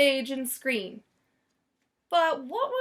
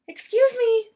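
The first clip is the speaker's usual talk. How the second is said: much higher, louder anxious lady's voice; frantic Missus Prim; 11 semitones higher, 7 dB louder than usual. A narrator's reading. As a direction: urgently catch the clerk's attention. Prim frantically searching for Lyle.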